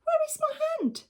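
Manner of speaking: very high, fast alarmed cry; alarmed question. Acted. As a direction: squeak it out in shock. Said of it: "he said".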